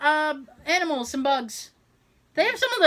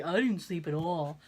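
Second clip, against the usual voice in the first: low, softer mumbled complaint; groggy husband imitation; 7 semitones lower, 6 dB quieter than usual. Husband waking up and complaining, lightly mimicked. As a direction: lightly mimic someone just woken. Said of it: "He wakes up and he's like".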